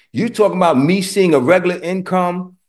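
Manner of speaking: ordinary talk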